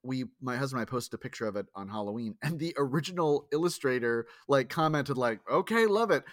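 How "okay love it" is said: mock straight guy. Illustrator's comment voiced.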